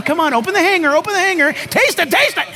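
high, playful airplane-spoon coaxing; coaxing a child to eat. Retold or imitated. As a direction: coax a picky toddler with the spoon. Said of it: "you're making airplane sounds".

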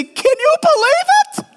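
high squeal of amazement; amazed disbelief. Retold or imitated. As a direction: gush like a starstruck follower. Voicing amazed disciple.

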